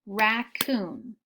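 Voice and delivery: Raccoon said slowly as rac-coon, two clapped beats; clapped syllables. Shown as a demonstration. Teacher to class, clapping Raccoon out in two beats. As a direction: split Raccoon into two even claps. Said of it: "I'm gonna do it first".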